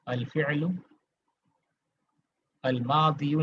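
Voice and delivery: "अल फ़िअलु अल मादियु" with both words separate and final -u voiced; lecture fautive montrée. Shown as a demonstration. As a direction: read "अल मादियु" with the full -yu ending, detached. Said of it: "नहीं पढ़ेंगे इसको".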